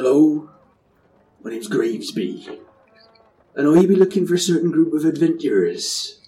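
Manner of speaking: hammed-up slow West Country pirate accent. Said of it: "he sounds like a West Country pirate".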